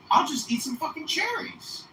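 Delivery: high, strained mock outburst in a put-on voice; exasperated outburst. Fed up with the pills, he imagines snapping in exasperation. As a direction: blurt it like you're fed up.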